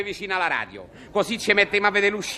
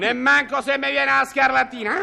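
normal talking voice

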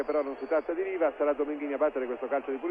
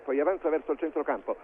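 usual speaking voice

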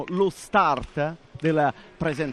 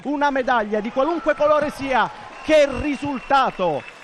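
excited; 6 semitones higher, 4 dB louder than usual. Real, not acted. Medal confirmed live.

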